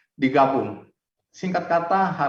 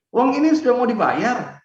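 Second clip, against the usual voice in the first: excited exclaim; 8 semitones higher, 5 dB louder than usual. Retold or imitated. Retelling the money was ready.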